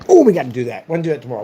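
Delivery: usual speaking voice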